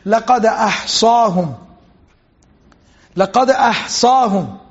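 fast, flowing chanted recitation of the verse; Quran recitation. Acted. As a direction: recite it with tajweed. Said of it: "Allah subhanahu wa ta'ala says".

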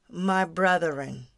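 normal talking voice

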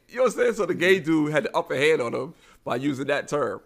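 ordinary talk, nothing marked

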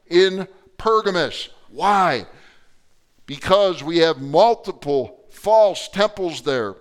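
loud, high, driving sermon delivery; preaching at full force. Real, not acted. Pastor preaching to his congregation, loud and urgent. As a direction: thunder the scripture out to the church.

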